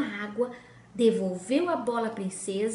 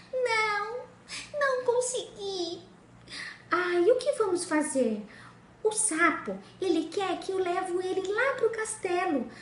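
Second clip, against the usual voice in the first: spoiled princess; 6 semitones higher than usual. Acted. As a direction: squeal it, half disgusted and half eager. Princess complains to sister.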